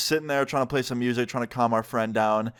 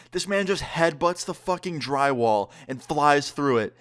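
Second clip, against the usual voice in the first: plain reading voice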